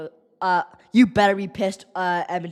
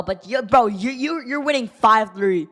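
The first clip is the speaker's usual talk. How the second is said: ordinary talk, nothing marked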